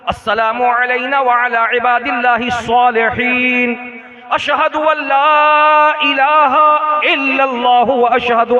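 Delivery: loud, measured prayer recitation; unhurried recitation. Shown as a demonstration. Preacher showing how to recite while seated in prayer, unhurried recitation. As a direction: intone it phrase by phrase, full-voiced and composed. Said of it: "इत्मीनान से पढ़ो".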